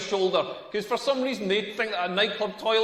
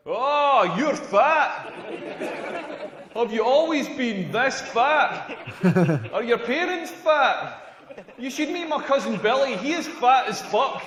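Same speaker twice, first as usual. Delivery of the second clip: mock rude stranger; 3 semitones higher, slower than usual. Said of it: "go up to a fat person and be like".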